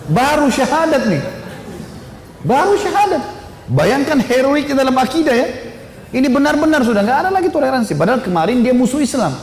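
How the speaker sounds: high-pitched preaching fervour; impassioned, exclaiming emphasis; fervent amazement. Real, not acted. Preacher marvels at the convert's zeal.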